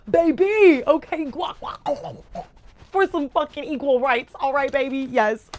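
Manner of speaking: high, loud hyped-up yelling; squealing with delight; excited hype. Real, not acted. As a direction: hype it up like cheering. Hyped shouting over gift.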